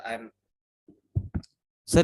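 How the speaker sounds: ordinary talk, nothing marked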